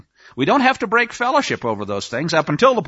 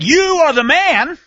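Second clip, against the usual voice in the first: prophet's accusation; 10 semitones higher, 5 dB louder than usual. Retold or imitated. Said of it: "When David was confronted and said".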